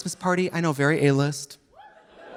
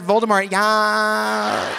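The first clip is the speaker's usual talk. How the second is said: slow Voldemort echo; 4 semitones higher, much slower than usual. Retold or imitated. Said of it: "He said".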